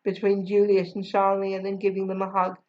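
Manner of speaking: ordinary delivery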